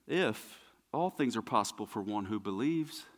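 low, soft, measured assurance; quiet response. Acted. Jesus answering the doubting father, quiet and low. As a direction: answer softly, letting it land.

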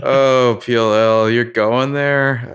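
loud, high and slow mock disbelief; mock outrage. Fans loudly mocking the show's twist, slow and high. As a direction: stretch it out like an offended fan.

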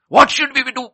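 high, loud shouted question; urgent cry. Acted. Crowd asking how to be saved, urgent shout. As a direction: call it out like a crowd needing an answer.

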